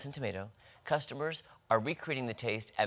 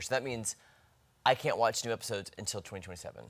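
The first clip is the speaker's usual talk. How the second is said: ordinary delivery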